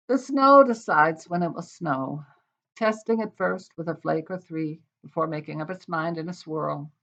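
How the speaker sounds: measured, expressive poetry reading voice; wry, deliberate poem recital. Acted. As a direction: read it like a poet at a reading. Reads her title poem.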